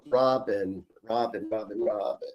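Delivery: low, soft repeated name; quiet calling. Real, not acted. Psychic seeking client's late mother, quietly calling. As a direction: murmur the name inwardly, as if tuning in.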